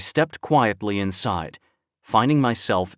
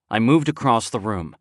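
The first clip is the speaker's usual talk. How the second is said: ordinary delivery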